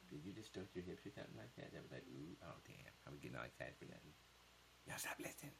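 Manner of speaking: very soft, near-whispered teasing; hushed private aside. Real, not acted. Teasing someone at home while viewers eavesdrop, hushed. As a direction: keep it from the listeners, barely voiced.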